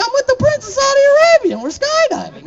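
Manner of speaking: high, excited bragging voice; giddy brag. Retold or imitated. Bragging to scared friends about the prince, high and giddy. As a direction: show off like a thrilled kid.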